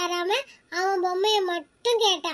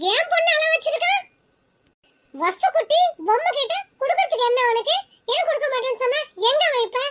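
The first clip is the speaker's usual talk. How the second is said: high, indignant scolding; angry mother; 5 semitones higher, faster than usual. Acted. Mother hunting the boy who refused her daughter, angry. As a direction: demand answers in a raised, outraged pitch.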